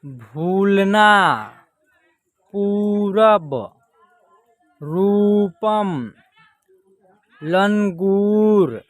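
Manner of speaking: slow word list, long vowels held, pauses between words; teacher sounding out single words; drawn-out model reading. Shown as a demonstration. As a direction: stretch each long vowel.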